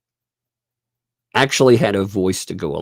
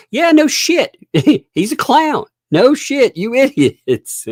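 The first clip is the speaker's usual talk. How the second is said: high, loud sarcastic retort; mock-outraged squeal at obvious news; mock exasperation; 14 semitones higher, 5 dB louder than usual. Answering his clown remark back to idiots, mock exasperation.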